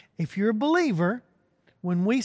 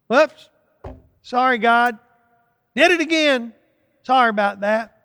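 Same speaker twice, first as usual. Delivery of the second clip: breezy, careless sorry, higher and louder; flippant mock apology; 4 semitones higher, 8 dB louder than usual. Preacher mimicking a careless believer, flippant higher, louder apology. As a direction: toss off the apology like it's nothing. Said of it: "I'm not sure how genuine, how sincere that is".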